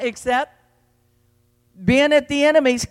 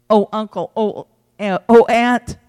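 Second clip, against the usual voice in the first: usual speaking voice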